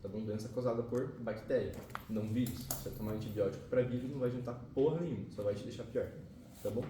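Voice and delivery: normal talking voice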